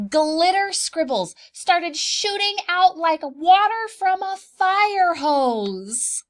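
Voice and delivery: awed storyteller building to a burst; wonder-struck excitement. Acted. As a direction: drag it out like the magic is exploding. Magic glitter bursts out.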